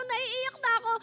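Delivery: high-pitched imitated near-crying; tearful whine. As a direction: whine it as if about to cry. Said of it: "sinisegoy-segoy ko pa".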